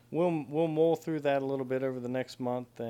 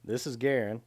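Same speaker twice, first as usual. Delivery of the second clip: podcast intro; 3 dB louder than usual. Acted.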